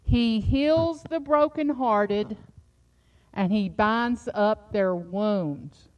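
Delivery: slow, solemn verse reading; scripture reading. Acted. Preacher reading the psalm to comfort the congregation. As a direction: read it slowly, like a promise. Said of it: "Psalms one forty-seven three".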